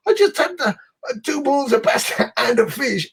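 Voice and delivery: high mock protest, laughing through it; retold disbelief. Diner retelling the arrival of meat, high laughing disbelief.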